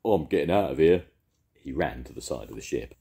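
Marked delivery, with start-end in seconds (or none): 0.0-1.1 louder, eager call; shout. A narrator's reading. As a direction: dash off shouting. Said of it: "shouted Duke".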